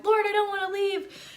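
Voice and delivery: high, tearful mock plea; girl pleading with the Lord, tearful; pleading cry. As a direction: beg the Lord through tears. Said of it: "And I just kept crying".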